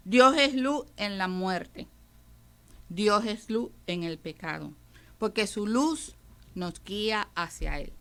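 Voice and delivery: ordinary delivery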